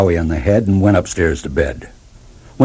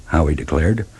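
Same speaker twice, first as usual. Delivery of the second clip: ordinary delivery, nothing marked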